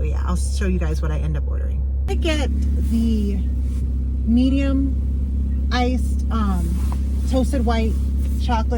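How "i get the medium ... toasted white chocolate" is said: speaking up. Real, not acted. Ordering at drive-thru speaker.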